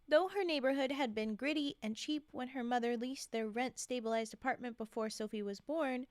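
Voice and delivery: usual speaking voice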